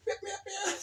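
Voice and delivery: high, silly spoken meows; high-pitched mock cat; cat woman meowing. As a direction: meow like a daft cat lady. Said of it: "She's a cat woman".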